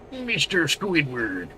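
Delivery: slow, drawn-out cartoon character voice; hammed-up cartoon voice, words stretched; cartoon character impression. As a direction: draw out each word like a cartoon boss demanding money. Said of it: "It's a pretty good impression".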